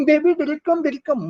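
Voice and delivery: high, hammed-up scared girlfriend; overacting girlfriend. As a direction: ham up a frightened girl clinging to her boyfriend. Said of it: "the girl was doing overacting".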